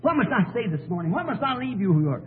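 ordinary talk, nothing marked

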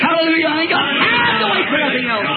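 high, fervent revival shouting; full-force preaching. Real, not acted. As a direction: exhort the congregation at full pitch.

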